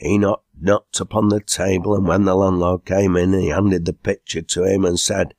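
usual speaking voice

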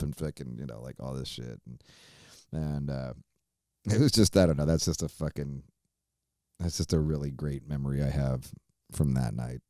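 ordinary delivery

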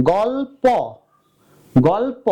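"গল্প" slowly as "gawl-paw"; spelling pronunciation. Shown as a demonstration. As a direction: open final অ in "গল্প". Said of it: "এটা উচ্চারণ করো". (বানান ধরে "গল-প")

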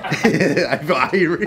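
very slow laughing irie; drawn-out mock Jamaican chill. Friends echoing in a slow mock patois.

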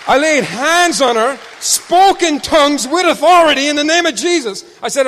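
loud, high, fervent proclamation; full-force preaching. Real, not acted. Preacher recounting praying over a child, loud and fervent. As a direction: declare it with authority.

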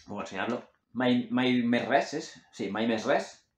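usual speaking voice

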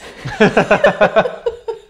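bright high burst of laughter; loud laugh. Real, not acted. Happy that audio is better.

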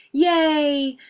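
high, loud, drawn-out cheer; yelled cheer. A narrator's reading. Guests cheering as the candles go out, yelled. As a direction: yell it out like party guests. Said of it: "the guests yelled".

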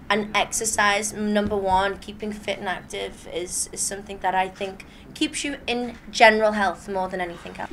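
usual speaking voice

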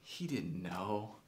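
soft, halting, dawning realization; hushed realization. Retold or imitated. Man watching his old coach at the gym, hushed realization. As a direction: let it sink in softly, with pauses.

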